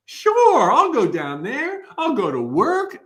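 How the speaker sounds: a soul gushing about going to work; eager spirit. Pretend. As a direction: sign up like a thrilled newcomer. Eager spirit choosing life.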